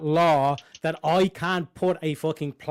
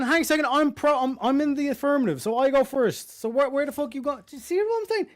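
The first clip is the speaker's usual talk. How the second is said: excited; 8 semitones higher than usual. Real, not acted. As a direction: cut in, pitch up high. Excited cutting in.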